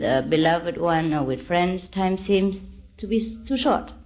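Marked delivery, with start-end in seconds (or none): none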